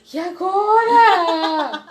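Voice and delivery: high, loud words broken by laughing sobs; half laugh, half cry in disbelief. Retold or imitated. Woman reliving her positive pregnancy test, laughing and crying. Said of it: "eu chorava, eu ria".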